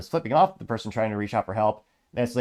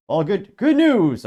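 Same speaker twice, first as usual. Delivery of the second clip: put-on much higher and louder, cracking old professor voice; Professor Farnsworth impression; 9 semitones higher, 8 dB louder, much slower than usual. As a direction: squeal "good news" like a doddering old scientist. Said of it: "I can't do his accent".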